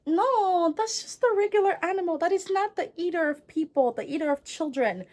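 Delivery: high-pitched, hammed-up protest; exasperated mom. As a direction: correct him as a mock-despairing mother. Acted exasperated mother corrects son.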